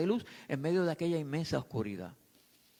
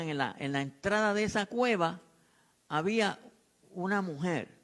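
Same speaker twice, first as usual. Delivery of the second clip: ordinary delivery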